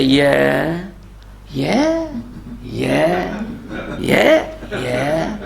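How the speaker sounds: vague, distracted yeahs going up and down; absent-minded yeahs. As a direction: nod along without listening. Rebbe's absent-minded yeahs, acted.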